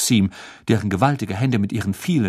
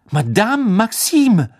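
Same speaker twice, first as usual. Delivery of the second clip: calling out loudly; 11 semitones higher, 8 dB louder, slower than usual. Acted. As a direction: call her name across the room. Fleur calls out to teacher.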